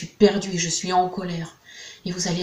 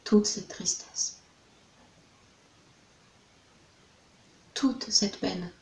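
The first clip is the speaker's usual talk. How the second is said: sorrowful whisper; 6 dB quieter, slower than usual. Acted. Guide voicing a client's sadness in a hushed whisper. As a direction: whisper the grief, slow and hushed. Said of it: "je suis triste".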